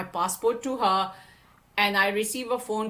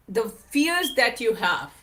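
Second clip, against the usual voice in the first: ordinary delivery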